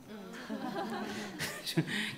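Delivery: silent wheezing laugh; unvoiced laugh. Real, not acted. Preacher chuckling over the sitcom scene, breathy laugh. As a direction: laugh without voice, breathy and high.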